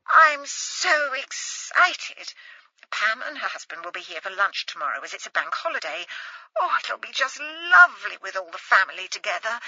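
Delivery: a timid posh mother thrilled her daughter is coming home; excited mother. Acted. Mother overjoyed on the phone.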